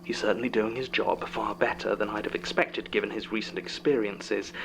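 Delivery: ordinary talk, nothing marked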